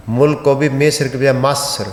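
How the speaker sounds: first "مصر" said "Misr", last "مصر" said "Masr"; vowel contrast. Shown as a demonstration. Teacher explaining driver's error, "مصر" as "Misr" then "Masr". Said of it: "زیر کی بجائے جہاں زیر ہونی چاہیے اس کو زبر".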